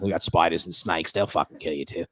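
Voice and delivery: low, soft Australian warning; Australian accent. Retold or imitated. Australian comic warning visitor off the beach, Australian accent. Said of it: "It's a good Australian accent".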